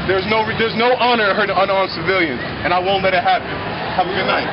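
loud angry voice, calming at the end; shouting down the cops, then easing. Real, not acted. Protester yelling at police.

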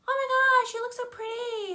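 very high, gushing star squeal; hammed-up pop-star compliment, loud at first. Star compliments the fan, squealing impression. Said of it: "And a bit more [name]-y".

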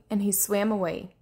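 ordinary delivery

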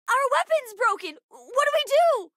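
high-pitched alarm, rising; frantic cry for help; panicked. Acted. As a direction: panic rising to a desperate question. Panic in space battle.

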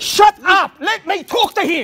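real angry shout, each word forced out; angry outburst. Real, not acted. Woman in parking row cutting in to be heard, angry shout. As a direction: shout over the person interrupting. Heated argument, cutting others off.

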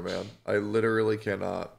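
normal talking voice

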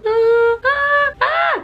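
ah ah ah cada vez mais agudos; gritinhos agudos. Real, not acted. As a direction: solte gritinhos de alegria. Risada eufórica. (gritinhos eufóricos subindo)

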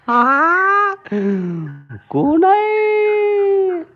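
very high, very slow squeal; squeaky baby voice. Pretend. Asking the kitten who's there, high drawn-out baby voice. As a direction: stretch each word in a squeaky baby voice.

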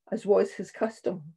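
ordinary delivery

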